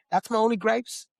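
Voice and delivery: ordinary talk, nothing marked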